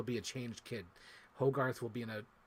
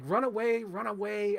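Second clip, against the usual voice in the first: urgent warning; 6 semitones higher, 4 dB louder than usual. Retold or imitated. Voicing movie character fleeing the army.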